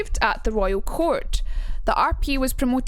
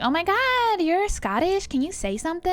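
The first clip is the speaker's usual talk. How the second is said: high, excited American tourist voice; mock an overexcited American, pitch swooping up; gushing American; 8 semitones higher than usual. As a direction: squealy fake American accent. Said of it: "go to America and they're like".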